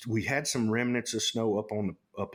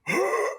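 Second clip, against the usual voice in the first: sharp high mock gasp of the bull; imitating the bull's high gasp; imitated elk gasp; 4 dB louder than usual. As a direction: suck in air like the startled elk. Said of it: "And he goes".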